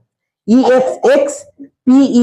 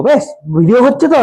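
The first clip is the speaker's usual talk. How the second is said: ordinary delivery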